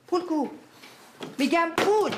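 furious sister demanding money; angry demand. Acted. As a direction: snap at him for the money.

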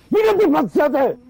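impatient shout. Acted. Impatient man yelling at a fidgety waiter. Repeats question, ignored first time.

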